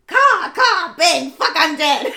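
shrill mocking caw caw, then shouted taunt; loud and obnoxious. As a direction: crow the words out big and obnoxious. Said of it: "he says it so loud and obnoxious".

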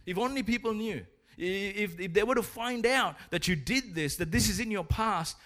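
high, urgent taunting voice; intimidating accuser. Acted. The enemy taunting someone about their hidden past, intimidating. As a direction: needle them with it, pitched well up. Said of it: "he intimidates you with things like".

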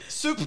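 Soup pitched very high in mock Cockney; high hammed-up Cockney Soup. Shown as a demonstration. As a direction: squeak out Soup, Cockney style. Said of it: "Yeah, that's it".

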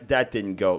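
ordinary delivery

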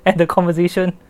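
laughing through the words. Real, not acted. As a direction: giggle while confessing. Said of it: "make a fool of myself".

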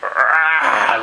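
short loud wolf-like roar; mock wolf roar; wolf growl. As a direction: snarl out like a wolf. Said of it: "like a wolf or something".

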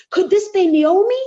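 excited crowd spotting Naomi's return; excited townsfolk. Retold or imitated. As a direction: gasp it out like surprised neighbours. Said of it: "everyone was so excited and said".

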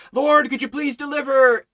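high, loud mock plea to God; pleading prayer. Preacher mimics believers praying, a mock plea. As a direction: voice the believer begging the Lord. Mocking prayer quote in sermon.

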